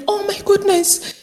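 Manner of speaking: high-pitched, overcome with wonder; emotional. Retold or imitated. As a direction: act an old lady deeply moved and amazed. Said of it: "it was quite so emotional for both of us".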